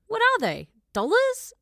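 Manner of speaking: very high, loud squeal of shock; high incredulous outburst; outraged disbelief. Acted. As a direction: shriek it, totally thrown. Sarah's shocked shouting.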